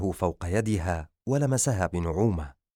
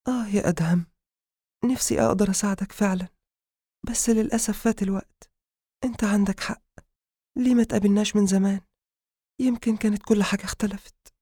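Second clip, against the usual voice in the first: high-pitched voiced lament, more sad than whispered; sorrowful; 12 semitones higher, faster than usual. Habiba laments to sleeping Adham that time has passed, sorrowful. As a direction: grieve aloud for a secret love. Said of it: "وهمست بحزن".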